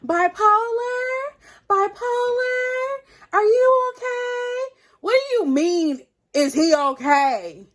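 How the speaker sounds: high, loud, drawn-out mom impression; boyfriend's mom. Mom calling to her son mid-argument, loud high mock call. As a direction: imitate a mother calling out, slow singsong questions. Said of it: "and then his mom".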